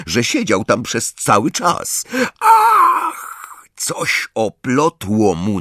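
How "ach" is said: very high, loud cry of surprise; sudden high yelp on falling; startled yelp. A narrator's reading. Harry is tripped while fleeing, startled yelp.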